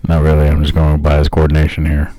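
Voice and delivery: deep, deliberate narrator voice; low-pitched mock-solemn delivery; Morgan Freeman. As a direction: stay way down low and measured. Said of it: "impersonating him now".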